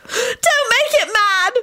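high, drawn-out frightened wail. A narrator's reading. Rosie pleads from the floor, wailing. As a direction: wail the plea high and slow. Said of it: "Rosie wailed from the floor".

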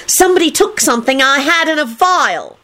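high, indignant accusation; high-pitched outrage; angry screech. A narrator's reading. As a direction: accuse them in a high, cross voice. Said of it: "Rosie screeched angrily".

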